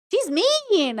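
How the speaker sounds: high, drawn-out complaint; swooping, whiny protest; whiny dismay. Real, not acted. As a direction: wail it in mild, exaggerated dismay. Reacting to insults she reads.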